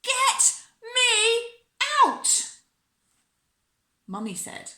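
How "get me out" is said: high, loud demand to be freed; shouting. A narrator's reading. Wriggling Bella wants out while shopping, shouting. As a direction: shout it, voice pitched right up. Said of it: "in the end I shouted".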